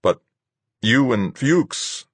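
high, slow, halting confusion; falter, lost for words; stammering. Acted. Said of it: "I stammered".

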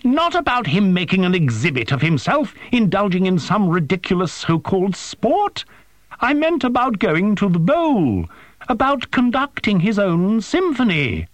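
high-pitched indignant tirade; angry drone. A narrator's reading. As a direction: scold at a high, agitated pitch.

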